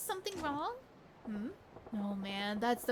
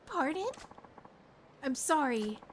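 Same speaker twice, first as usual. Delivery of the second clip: higher, wounded and upset; hurt girlfriend; 6 semitones higher than usual. Acted. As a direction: let the betrayal crack through. Girlfriend learns it is fake.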